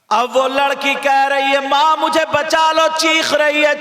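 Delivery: high, sing-song preacher's chant; preaching at full force. Acted. Preacher warns village girls about disobeying mothers, chanting. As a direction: preach the tale to the crowd, chanting.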